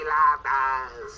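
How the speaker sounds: slow hammy fake Mexican accent. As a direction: draw out enchiladas in a mock accent. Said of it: "It's insulting".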